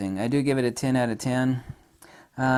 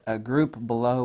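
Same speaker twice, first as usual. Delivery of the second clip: ordinary talk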